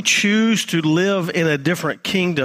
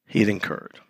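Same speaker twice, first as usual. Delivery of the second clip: ordinary talk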